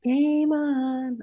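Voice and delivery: high but soft, held "game on"; imitated catchphrase. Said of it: "You're saying it wrong".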